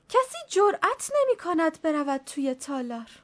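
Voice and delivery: put-on high-pitched, fretful old nanny; lightly voiced superstitious old nanny; old servant woman. A narrator's reading. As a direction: let the old woman ramble anxiously.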